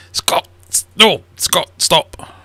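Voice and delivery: loud high yell to stop the cat; sharp yell. Real, not acted. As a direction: shout the cat's name to stop it. Scolding the cat.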